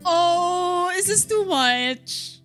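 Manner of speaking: usual speaking voice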